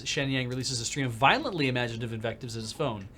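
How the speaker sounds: usual speaking voice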